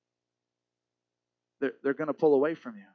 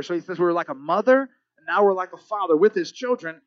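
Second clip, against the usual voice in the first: ordinary talk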